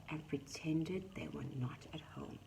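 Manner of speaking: hushed, secretive narration; whispering. A narrator's reading. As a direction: whisper like hiding.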